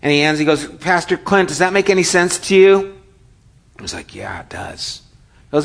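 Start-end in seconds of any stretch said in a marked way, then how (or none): 0.6-3.0 put-on higher, eager teen voice; eager car wash boy. Car wash boy asking Pastor Clint for a word, eager and higher. 4.1-5.0 much softer, lower, shaken yes; stunned quiet answer. Retold or imitated. Shaken Clint quietly admitting it makes sense to the boy.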